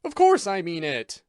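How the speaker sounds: thundering. A narrator's reading. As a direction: thunder it at him. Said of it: "I thundered at him".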